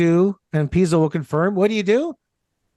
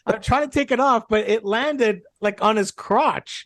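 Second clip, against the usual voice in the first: usual speaking voice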